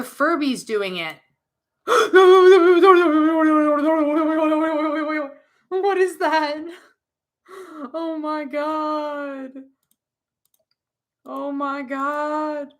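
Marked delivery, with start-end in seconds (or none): 1.9-5.3 a long, high, loud shriek of fright; loud frightened gasp and cry. Acted. A child startled by a creepy toy, screaming high and loud. Jump scare in toy store. 5.7-12.7 shaken. Acted. Shaken after the scare.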